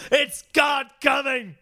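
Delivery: high, loud, drawn-out holler; mock proclamation. Co-host announcing milk raining from the sky, mock proclamation. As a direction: holler it like a revelation.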